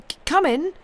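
high, loud startled call; crying out. A narrator's reading. Master in bed answering the door, crying out. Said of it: "cried Mister Jarvie".

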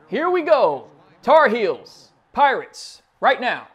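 loud, high kickoff call; hype call. Real, not acted. Gamer hyping the kickoff, loud and high. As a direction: fire up the game start.

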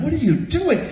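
high-pitched outraged question; retold argument, voice shooting up; indignant. Storyteller argues back at the yelling employee, indignant.

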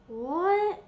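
confused yelling at the screen; startled and loud; alarmed shouting. Real, not acted. Sudden game event, panic.

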